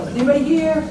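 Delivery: a mock yell to check the room is empty; retold yell. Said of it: "And she yells out".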